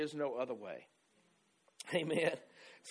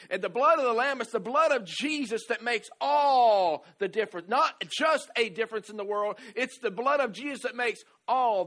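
loud, high preaching; preaching at full force; 6 semitones higher, 10 dB louder than usual. Acted. Pastor preaching to the congregation, loud. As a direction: drive the point home to the church.

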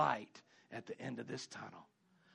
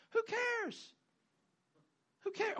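dismissive retort; 4 dB quieter, slower than usual. Acted.

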